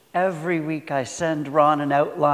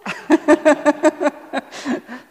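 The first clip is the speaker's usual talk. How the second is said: high, bright burst of real laughter; delighted laugh; 11 semitones higher, 4 dB louder than usual. Real, not acted. Pastor delighted by Ron's perfect hymn picks, laughing high. Delighted by organist's quick hymns.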